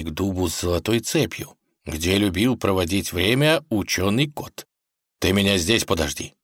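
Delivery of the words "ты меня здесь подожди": ordinary delivery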